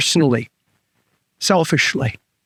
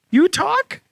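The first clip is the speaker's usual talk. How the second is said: surprised guy; 12 semitones higher than usual. Acted. Guy asks dog in joke.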